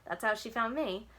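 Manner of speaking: ordinary delivery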